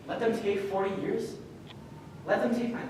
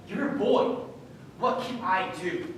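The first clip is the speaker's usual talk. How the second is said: ordinary talk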